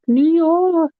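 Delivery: drawn-out, high attempt at a New York accent; a hesitant, high stab at a New York accent. Shown as a demonstration. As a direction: try a shaky New York accent, slowly. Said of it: "That's all I can say".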